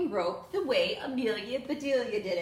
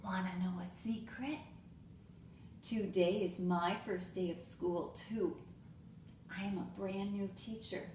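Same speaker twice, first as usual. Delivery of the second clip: whispered; 3 semitones lower, 7 dB quieter than usual. A narrator's reading. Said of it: "she whispered".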